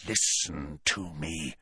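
low, soft and slow. A narrator's reading. Snape warning Malfoy behind a door, low and slow. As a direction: hush it, deadly serious. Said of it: "his voice so low now".

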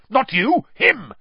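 high, loud impatient growl; Moody. A narrator's reading. Moody corrects Harry, pointing at Crabbe, loud growl. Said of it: "Moody growled".

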